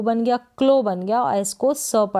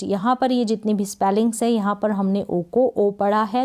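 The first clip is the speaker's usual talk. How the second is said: ordinary talk, nothing marked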